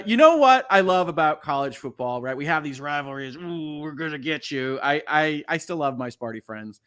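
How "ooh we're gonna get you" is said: slow, playful teasing threat; a hammed-up sports rival's taunt; mock taunt. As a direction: rib the rival fans gently.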